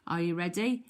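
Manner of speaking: ordinary talk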